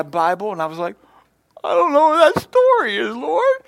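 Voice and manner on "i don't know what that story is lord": high, loud voice breaking in a cry. Retold or imitated. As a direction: wail it to the Lord in tears. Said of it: "And I was crying about that".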